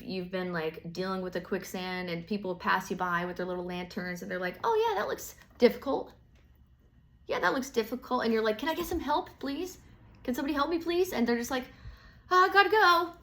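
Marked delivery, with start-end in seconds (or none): 4.6-8.2 breezy, much higher dismissive voice; offhand passerby. Retold or imitated. Passerby shrugging off stuck Capricorn, airy high voice. 8.6-11.1 higher, imitating a plea for help; mock pleading. Stuck Capricorn begging passersby, mock desperate plea. 12.3-13.1 loud, much higher hurried goodbye; breezy brush-off. Retold or imitated. Passerby ditching stuck Capricorn, loud high brush-off.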